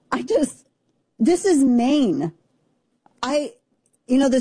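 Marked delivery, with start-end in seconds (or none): 1.2-2.3 "this is Maine" drawn out slowly, hitting "is"; slow and incredulous, leaning on "is"; emphatic. Real, not acted. Stressing that it happened in Maine, not Mississippi. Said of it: "stressed for disbelief".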